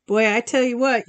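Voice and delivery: usual speaking voice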